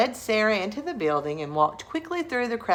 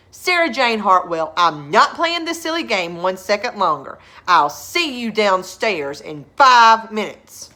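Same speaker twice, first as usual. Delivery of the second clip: stern dad; 3 semitones higher, 10 dB louder than usual. Acted. Father ordering Sarah downstairs, loud and stern. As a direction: lay down the law. Father has had enough.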